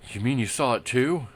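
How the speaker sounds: startled. Acted. Startled outlaw replies.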